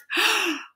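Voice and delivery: high frightened gasp; mock fearful gasp; scared gasp. Shown as a demonstration. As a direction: gasp like you saw something scary.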